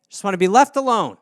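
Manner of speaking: usual speaking voice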